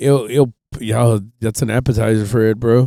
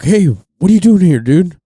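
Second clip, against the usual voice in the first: calling out louder and higher like a ranger; park ranger; 8 semitones higher, 7 dB louder than usual. Retold or imitated. Ranger catching him in the woods, calls out loud. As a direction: hail the trespasser from across the woods. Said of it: "he's like".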